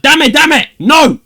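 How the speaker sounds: hammed-up outraged shouting; over-the-top yell at the pen pal; mock furious yell. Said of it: "I was like".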